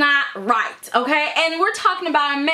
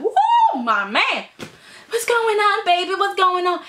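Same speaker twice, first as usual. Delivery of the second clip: excited greeting; 6 semitones higher than usual. Retold or imitated. Quinn runs up to husband.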